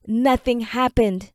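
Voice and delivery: ordinary delivery, nothing marked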